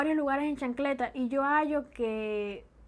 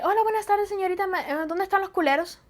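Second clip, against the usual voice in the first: higher-pitched polite customer; innocent shopper; 7 semitones higher, 3 dB louder than usual. Acted. Cuban parent asking the supermarket girl for diapers, polite. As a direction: ask it politely in a raised, lilting voice. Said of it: "llegado a un supermercado así que".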